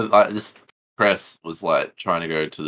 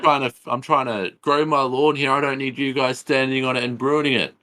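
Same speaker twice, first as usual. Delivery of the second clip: higher-pitched mock grumble of a lawn owner; complaining homeowner; 8 semitones higher than usual. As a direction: plead your case as the put-upon homeowner. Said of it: "and S P L's like".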